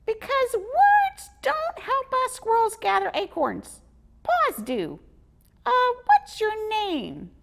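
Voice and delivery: high, loud squeaky squirrel; exasperated little squirrel. Acted. Squizzy exasperated with Marcus, squeaky.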